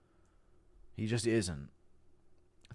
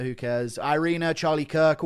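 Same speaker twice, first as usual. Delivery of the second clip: ordinary talk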